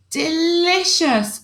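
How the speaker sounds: high, slow savouring delicious; delighted alien. Acted. Alien tasting chocolate cake for the first time, slow and delighted. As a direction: draw out the taste slowly.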